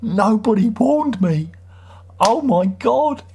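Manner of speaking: high, slow, hammed-up horror; mock shock. To commenters calling him bald, hammed-up mock horror. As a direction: overplay being shocked by the news. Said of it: "and I go".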